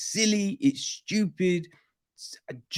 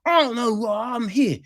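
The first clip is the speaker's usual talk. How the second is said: high-pitched huffy expert with gasps; mock indignant; 8 semitones higher than usual. Expert dragged in, starting to protest, mock indignant. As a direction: act out hammed-up indignation, oohing and gasping. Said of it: "starting to get indignant".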